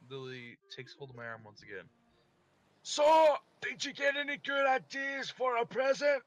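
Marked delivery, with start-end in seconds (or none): 2.8-6.2 put-on girl's voice. Voicing Lily, high.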